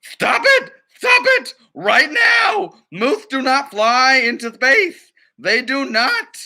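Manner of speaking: high, loud frantic scolding; angry yell. Acted. Duck director yelling at the flying moose, high angry shout. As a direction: scream at them to stop.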